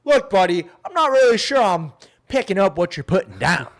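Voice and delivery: loud, blunt and frank; a sales contact getting loud and blunt; blunt salesman. Acted. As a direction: lay it out straight, loudly. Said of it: "just straight up like".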